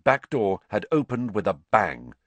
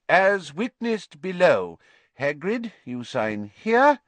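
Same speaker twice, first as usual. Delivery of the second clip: slow, raised official voice; formal official; 7 semitones higher, 4 dB louder, slower than usual. Acted. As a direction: read it out like a clerk. Committee member reads formally.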